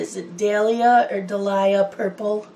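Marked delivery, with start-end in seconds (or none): 0.4-1.1 "Dahlia" said DAL-ya, stress up front; "Dahlia" opening on a stressed DAL; first pronunciation. Shown as a demonstration. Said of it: "I never know how to pronounce it". 1.2-2.5 "Dahlia" said duh-LIE-uh, middle syllable stressed; alternative pronunciation. Shown as a demonstration. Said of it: "I never know how to pronounce it".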